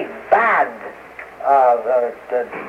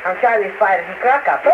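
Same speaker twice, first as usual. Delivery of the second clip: ordinary talk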